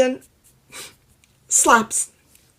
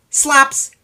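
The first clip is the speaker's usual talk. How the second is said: loud call; 7 semitones higher, 9 dB louder than usual. Acted. Storyteller shouting to children, loud call. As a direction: shout out slops. Storyteller calls out sound word.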